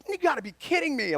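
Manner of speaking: high, quick, giddy disbelief; retold delighted amazement; so excited. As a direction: rush it out high, imitating someone thrilled. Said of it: "he's so excited".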